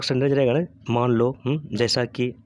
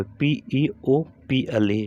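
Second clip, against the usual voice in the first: slow spelling, one letter at a time; deliberate letter-by-letter delivery; much slower than usual. Shown as a demonstration. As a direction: give each letter its own beat. Teacher spelling out a word.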